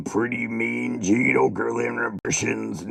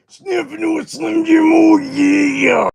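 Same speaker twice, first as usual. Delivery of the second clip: high, loud raspy-free shouting wrestler promo; Macho Man; 6 dB louder than usual. Retold or imitated. Streamer doing his Macho Man impression, shouted. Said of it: "I've been doing Macho Man".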